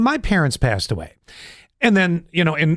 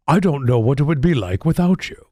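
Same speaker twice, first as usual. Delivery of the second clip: measured, put-on captain voice; heartfelt speech in a theatrical impression; Picard; 3 semitones lower than usual. Shown as a demonstration. Father to his newfound son, solemn captain impression. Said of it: "See if I can do the impression".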